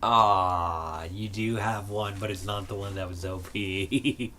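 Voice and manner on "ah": ordinary talk, nothing marked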